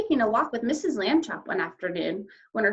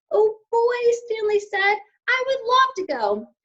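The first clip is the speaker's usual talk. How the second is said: put-on much higher, louder excited boy voice; thrilled; 13 semitones higher, 7 dB louder than usual. A narrator's reading. Stanley thrilled at the invitation to California. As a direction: bubble over with delight.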